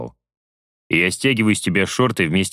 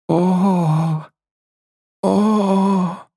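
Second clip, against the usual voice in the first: hammed-up moan; 11 semitones higher than usual. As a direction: overplay the moans. Hammed moans.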